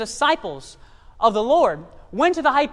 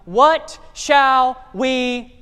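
drawn-out question; 6 dB louder, much slower than usual. Acted. Drawn-out quote awaiting congregation.

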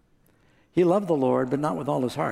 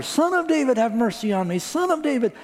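very high, loud desperate cry; calling out even louder; 10 semitones higher than usual. A narrator's reading. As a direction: shout it higher than anyone can hush. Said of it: "He called out even louder".

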